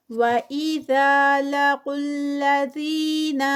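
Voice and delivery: whole phrase chanted, وَإِذَا drawn out melodically; Quran recitation. Shown as a demonstration. Teacher chants the verse before breaking it down, melodic recitation. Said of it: "ذا پہ ٹو counts".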